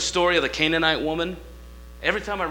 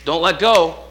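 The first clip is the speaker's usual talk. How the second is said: preaching at full force; 3 semitones higher, 8 dB louder, slower than usual. Acted.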